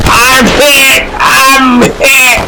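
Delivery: drawn-out shout of alarm; wounded soldier yelling for help; wounded cry. Acted. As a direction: cry out as if just shot. Soldier shot in battle.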